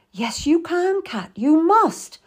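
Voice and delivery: high, forceful urging; urgent insistence. A narrator's reading. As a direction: push her on, refusing to let her quit. Meady urges mother on.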